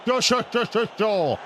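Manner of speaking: high, rapid mocking repeats of sure; mock it with a chirpy string of sures; sarcastic sure. Real, not acted. Gamer mocking the keeper's match rating, sarcastic high sures.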